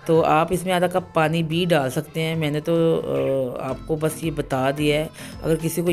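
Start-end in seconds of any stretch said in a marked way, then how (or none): none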